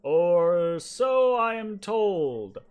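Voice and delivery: slow, loud, ponderous tree voice; booming tree. Acted. Tree telling a visitor of its creator, booming. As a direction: boom it slowly, drawing out each phrase. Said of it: "you hear this booming voice".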